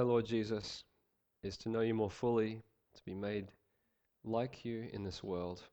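soft, low, earnest prayer. Real, not acted. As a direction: pray quietly and unhurriedly, with pauses between phrases. Leads the congregation in prayer.

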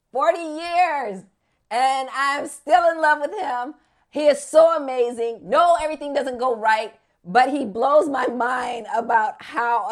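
loud, impassioned testimony; fervent praise. Real, not acted. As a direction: proclaim it with real wonder, leaning hard. Testifies fervently about her faith.

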